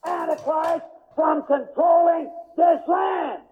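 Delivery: full-force pulpit preaching; fiery preaching. Acted. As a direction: thunder it at the congregation.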